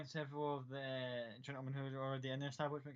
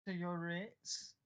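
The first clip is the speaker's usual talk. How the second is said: slow sleazy host; 5 semitones higher, much slower than usual. Acted. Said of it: "looks you up and down and goes".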